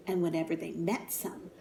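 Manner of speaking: ordinary delivery, nothing marked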